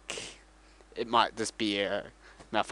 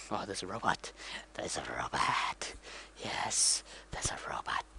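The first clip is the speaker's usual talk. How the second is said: soft unvoiced whisper, dropping lower; whispering Jawas; 6 semitones lower, 7 dB quieter than usual. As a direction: hiss it out like sneaky little scavengers. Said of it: "they're like".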